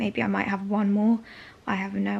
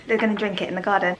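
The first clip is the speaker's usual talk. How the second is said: ordinary talk, nothing marked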